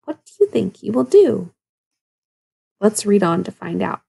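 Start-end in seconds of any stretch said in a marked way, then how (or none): none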